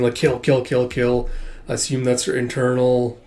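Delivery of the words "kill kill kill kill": usual speaking voice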